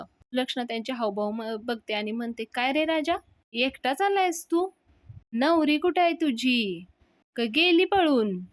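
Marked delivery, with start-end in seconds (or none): 2.5-8.4 higher, louder slow jeering; taunting jeer. Retold or imitated. Sulakshana mocking Raja about the missing bride, slow and loud. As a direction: drag out the taunt to shame him.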